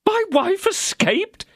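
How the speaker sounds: high, slow, disbelieving cry; shocked cry. Acted. Husband hears his wife escaped, shocked high cry. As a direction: drag it out in shock. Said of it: "cried Bonacieux".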